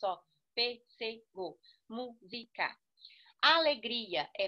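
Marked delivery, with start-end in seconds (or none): 0.5-2.8 Pêssego, música split into pês-se-go, mú-si-ca; syllable by syllable. Shown as a demonstration. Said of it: "Tem três sílabas". (Pêssego e música separados sílaba por sílaba)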